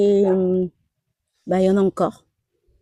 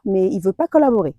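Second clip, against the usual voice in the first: usual speaking voice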